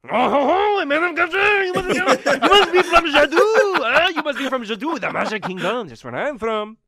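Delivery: put-on very high, loud delighted French accent; hammed-up French laugh and gushing welcome; thrilled fellow countryman, over-the-top French; French frog.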